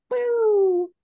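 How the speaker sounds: sudden very high shrieked boo; jump-scare boo at the top of the voice; playful shriek. Acted. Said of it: "Just be like".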